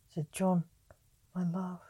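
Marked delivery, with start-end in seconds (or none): 1.3-1.8 faint deathbed whisper. Acted. Dying Mary's last words.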